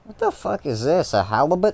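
surprised. Real, not acted. Reacting to something odd.

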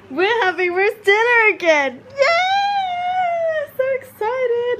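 excited. Real, not acted. Thrilled about roast dinner.

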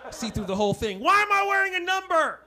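a hammed-up paranoid runner seeing through it all; tripping runner.

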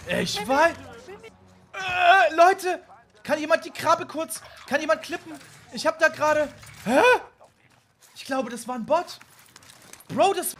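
frantic high shouting; panicking gamer. Real, not acted. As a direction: yell frantically for help.

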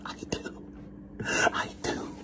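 unvoiced breathy whisper. Real, not acted. Speaker whispering alone to the camera, hushed. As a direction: whisper it close to the mic.

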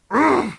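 loud, high strangled growl; growl of rage. Real, not acted. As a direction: burst out in wordless frustration. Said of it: "so much rage".

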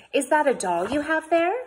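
high, loud, interested question; lady addressing child. A narrator's reading. Missus Frink asking little Sudie, interested high voice. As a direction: pitch it up for a small child.

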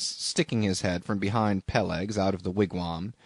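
plain reading voice

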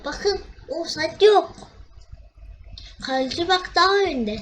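annoyed offside complaint; indignant protest. Real, not acted. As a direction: argue with the ref.

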